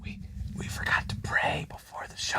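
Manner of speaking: hushed, alarmed whisper. Real, not acted. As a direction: whisper it like a guilty secret. Sudden realisation, whispered.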